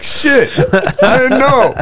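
high, loud flustered protest; mock panic. Friend answers being told off about wires, mock panic. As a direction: imitate a rattled friend crying out in defence. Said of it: "a little bit panicked".

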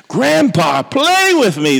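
put-on high, pleading little-boy voice; pitiful child. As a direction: beg grandpa like a sad toddler. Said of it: "in a pitiful voice".

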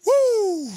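long, high falling "woo"; loud whoop sliding down from the top; preacher's whoop. Real, not acted. Preacher overcome while praising before the church, high whoop.

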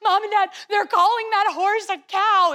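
mock tearful complaint to the parents; hammed-up upset kid telling on sisters; imitating crying little sister. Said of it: "She'd start crying and say".

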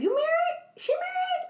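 very high incredulous squeal; mock disbelief. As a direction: imitate his stunned questioning, pitch shooting up. Retells his shock at her marriage.

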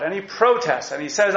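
ordinary talk

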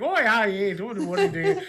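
Canadian attempt slipping into hammed-up Irish or Scottish; botched Irish or Scottish accent, climbing into laughter; bad Irish-Scottish accent. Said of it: "like, some stupid Irish or Scottish".

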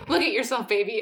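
ordinary delivery

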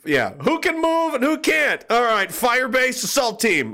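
high, slow mock battlefield call; hammed-up military shout; squad leader. Acts a squad leader calling out.

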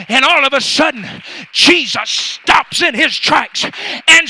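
loud, driving pulpit shout; full-force preaching. Acted. Preacher urging the church to pray until, full-force preaching shout. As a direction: preach it hard, punching the key words.

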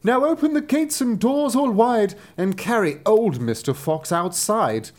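joyfully. Acted. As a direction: fling the doors open with glee. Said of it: "she said joyfully".

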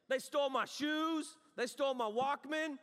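indignant shout. Retold or imitated. Preacher shouting to the congregation about his stolen things. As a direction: cry out the injustice. Outraged repeat of theft.

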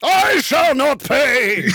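high booming slow wizard shout; Ian McKellen refusing a ticket. Retold or imitated. A wizard shouting down the parking officer. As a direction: thunder it out like a wizard who won't pay. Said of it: "that's when you're a real impressionist".

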